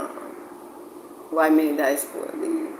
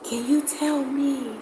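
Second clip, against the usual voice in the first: hushed, high, soft and drawn-out; demonstrated whisper; 8 semitones higher, 8 dB quieter, slower than usual. Retold or imitated. Asking discreetly in a place full of people, whispering. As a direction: whisper it slowly, as if avoiding notice. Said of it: "I will whisper it".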